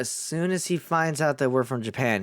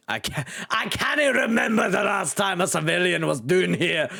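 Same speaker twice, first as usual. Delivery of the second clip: put-on Scottish accent; 6 semitones higher than usual. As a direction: call it out in a broad Scots brogue. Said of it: "a kind of okay accent for Irish slash Scottish".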